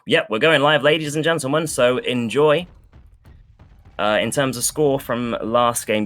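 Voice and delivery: ordinary talk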